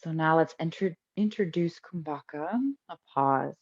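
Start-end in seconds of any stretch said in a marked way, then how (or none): none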